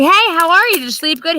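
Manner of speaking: high, loud, sing-song hello; sugary morning greeting. Retold or imitated. Wife cheerfully greeting her grouchy husband, high and loud. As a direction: gush it up high and sweet.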